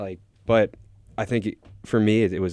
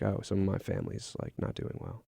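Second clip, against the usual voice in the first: hushed retelling; 12 dB quieter than usual. Retold or imitated. Roommate's sad reply retold.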